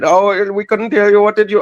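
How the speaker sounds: high-pitched cashier asking again; fast food cashier. Retold or imitated. Cashier voice in retold scene.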